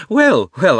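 loud, high, false chuckle; forced laugh. A narrator's reading. Uncle smooths away his horror, forced laughter. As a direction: laugh it off unconvincingly. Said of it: "with a rather forced laugh".

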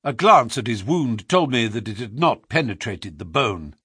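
ordinary delivery, nothing marked

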